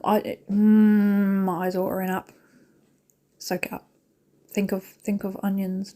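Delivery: ordinary talk, nothing marked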